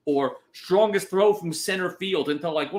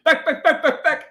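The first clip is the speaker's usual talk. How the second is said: loud, much higher "back, back, back" chant; Berman home run call; 11 semitones higher, 6 dB louder than usual. Retold or imitated. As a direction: call it like a TV announcer on a homer. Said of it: "you don't even get Berman".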